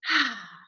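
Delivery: contented sigh on a good day; sigh of contentment. Shown as a demonstration. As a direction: melt into an easy ah. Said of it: "a sigh of contentment".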